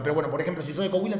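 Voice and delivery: ordinary talk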